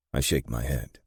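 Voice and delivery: plain reading voice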